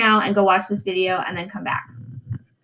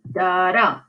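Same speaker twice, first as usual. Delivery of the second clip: da-dum, light then heavy; iamb rhythm; 3 semitones lower than usual. Shown as a demonstration. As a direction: tap out a heartbeat. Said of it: "that's an iamb or an iambic foot".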